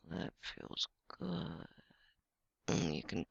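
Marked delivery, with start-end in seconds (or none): 0.1-1.7 soft breathy pleasured whisper, slow. Acted. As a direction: sigh it out on the breath. Pleasure during fantasy.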